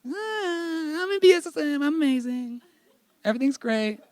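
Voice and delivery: squeaky sarcastic impression of a shallow believer; mock fake-happy student. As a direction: parody someone bragging that life is perfect. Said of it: "as opposed to all of the".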